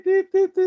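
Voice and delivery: high, loud imitated bleep tones; censor bleep. Shown as a demonstration. Teacher censoring a fan's insults with a mock high bleep. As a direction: beep out the swear words with your voice. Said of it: "un montón de puntitos ahí".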